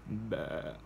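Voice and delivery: low, short "bah" like a sheep; half-hearted sheep impression; sheep bleat. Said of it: "sheep impression".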